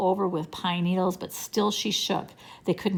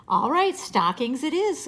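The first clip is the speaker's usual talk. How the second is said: higher, lightly amused agreement; trying not to laugh; 7 semitones higher than usual. A narrator's reading. Jessie accepts Benny's name for the bear, suppressing laughter. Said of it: "trying not to laugh".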